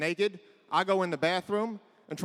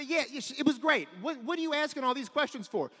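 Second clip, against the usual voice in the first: flustered son; 8 semitones higher, 4 dB quieter than usual. Retold or imitated. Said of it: "I say".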